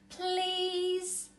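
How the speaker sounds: high, drawn-out please; child pleading with mum for a polar bear. Acted. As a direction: plead sweetly. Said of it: "I say".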